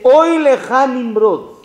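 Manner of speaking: slow, loud, high lament over Nimrod; a preacher crying woe on a king; solemn rebuke. Acted. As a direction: draw out "Oy leja Nimrod" like a prophet's warning. Abraham rebukes Nimrod, preacher declaiming.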